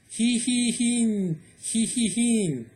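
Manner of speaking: high, long whinnying neigh; a horse whinnying in a high voice. Acted.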